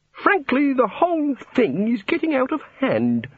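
high-pitched, fretful god voice; fussy god. A narrator's reading. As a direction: voice a flustered god, pitched well up. The god speaks in story.

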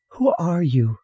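put-on high child's question; demanding little girl. A narrator's reading. Girl questioning a silent stranger, demanding child voice. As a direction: pipe up and demand an answer. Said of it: "Sally demanded at last".

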